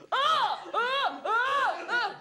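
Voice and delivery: short loud high yelps, over and over; mock screams of pain. Stuntman stung by bees, screaming in mock pain. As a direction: yelp like bees are stinging you.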